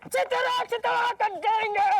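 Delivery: high-pitched, hammed-up bandit threat; dacoit threat. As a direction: declaim it like a stage dacoit. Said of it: "डाकू की dress में उसे धमकाएंगे".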